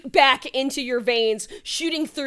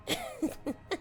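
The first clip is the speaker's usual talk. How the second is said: ogre chuckle; 14 semitones higher, 12 dB quieter than usual. Acted. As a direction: chuckle like a villain about to strike. DM voices ogre laughing.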